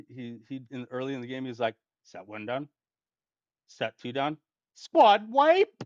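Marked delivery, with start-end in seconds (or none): 2.0-5.8 imitating annoying teammate. Player mocking a teammate's in-game sound bites, annoying call-out imitation. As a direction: mimic his call-outs, leaping up at the end. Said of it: "He's, like, talking like that".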